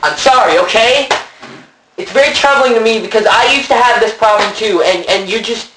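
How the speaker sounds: loud, high, heated shouting; angry shouting. Acted. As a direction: yell back in a heated argument. Heated mock quarrel.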